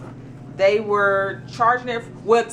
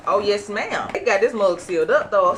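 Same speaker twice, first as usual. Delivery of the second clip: normal talking voice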